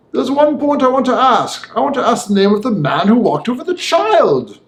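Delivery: put-on a careful old lawyer pressing a point; the lawyer Utterson.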